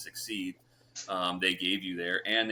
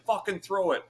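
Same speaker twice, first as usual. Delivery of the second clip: higher, taunting snap from the champ; impatient champ; 11 semitones higher than usual. Retold or imitated. Champ taunting him to throw the kick, impatient. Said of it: "He's like".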